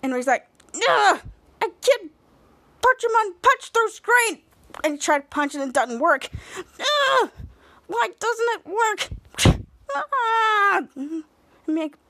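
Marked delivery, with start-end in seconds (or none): 0.7-4.4 high, straining mock frustration; frustrated. Ray tries to punch through the screen, frustrated. 6.6-10.8 high, drawn-out exasperated whine; frustrated. Retold or imitated. Ray's punch at the screen fails, mock frustrated.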